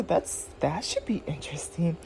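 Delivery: soft whispered aside; whispering. Real, not acted. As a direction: whisper it to yourself. Whispered aside after slip.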